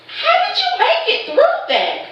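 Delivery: high astonished disbelief; incredulous question. Retold or imitated. Wife asking husband how he survived, high astonished. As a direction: gasp it out in disbelief at his stories. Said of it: "And I'm like".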